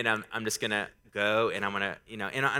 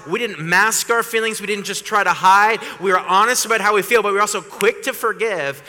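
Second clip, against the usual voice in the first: high, loud, driving sermon voice; full-force preaching; 8 semitones higher, 9 dB louder than usual. Acted. Pastor urges the church to forgive quickly, preaching loud and high. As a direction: preach it hard to the congregation.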